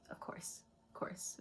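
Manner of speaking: breathy unvoiced of course; soft confidential whisper; whispered aside. Real, not acted.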